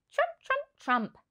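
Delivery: very high trumpet call like an elephant. A narrator's reading. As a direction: blast it high like an elephant's trunk. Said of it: "The elephant trumpeted".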